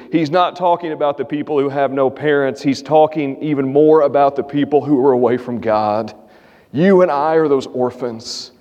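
normal talking voice

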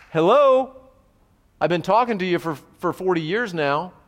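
sudden high, loud sarcastic "Hello," then settling; mock-indignant, fed-up jab at the people; exasperated retort. Preacher voicing the urge to tell off stubborn listeners, exasperated and loud. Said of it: "your temptation is gonna be".

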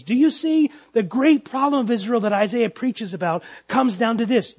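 usual speaking voice

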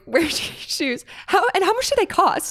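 put-on high, curious little girl voice; high childlike voice. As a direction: ask like an innocent kid. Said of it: "when she was like".

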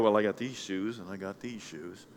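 low, soft musing to oneself; a listener tallying shoes softly; counting shoes. Retold or imitated. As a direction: count them up under the breath. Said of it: "a good number of you are here and go".

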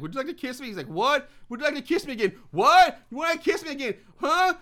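high, loud hammed-up straining to hear; mock shouting over music. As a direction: yell like you can't hear over a blaring stereo.

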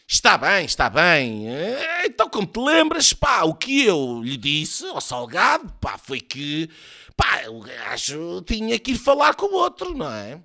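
high, loud, swooping character voice; hammed-up, hemming and hawing; rambling old politician. A narrator's reading. Voiced Soares rambles in satire.

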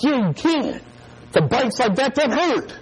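put-on high-pitched insistent kid; mock alarm. Boy insisting to his brother, mock alarm. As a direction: argue back in a high voice. Acting out childhood prank argument.

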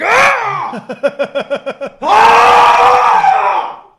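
imitating the locked-out dad screaming to be let in; mock frantic yelling. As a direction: scream like a man locked out of his house. Locked-out neighbor banging on door.

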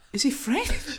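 high-pitched baffled question, imitated; retold incredulity. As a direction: mimic someone astonished, pitch jumping up.

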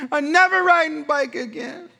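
put-on high, loud, whiny little boy vow; upset child. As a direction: play the sulking son refusing the bike. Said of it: "and he goes".

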